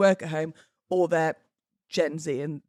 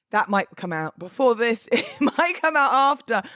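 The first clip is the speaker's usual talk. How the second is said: laughing; 4 semitones higher, 5 dB louder than usual. Real, not acted. Laughs at her own muddle.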